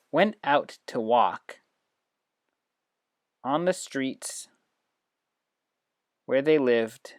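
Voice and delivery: put-on animated read-aloud for young students; storytelling to children. Shown as a demonstration. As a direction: tell it big and slow so the app can follow. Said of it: "on the app tap to get things started".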